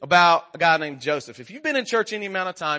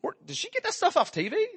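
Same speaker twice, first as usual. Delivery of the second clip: high-pitched, incredulous parent; baffled parent; 11 semitones higher, 4 dB quieter than usual. Retold or imitated. Another girl's parent asking us about her, baffled. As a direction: imitate a taken-aback parent, pitched way up.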